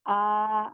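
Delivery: आ held for two counts; drawn out aa demo; long aa vowel. Shown as a demonstration. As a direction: stretch आ out evenly. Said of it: "अलिफ मद की आवाज जो होगी वो होगी आ".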